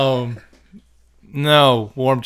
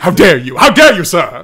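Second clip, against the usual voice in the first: mock outrage; 2 semitones higher, 6 dB louder than usual. Mock offence for someone's grandfather.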